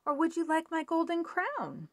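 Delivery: high, offering princess voice; lightly voiced princess. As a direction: tempt the frog with the crown.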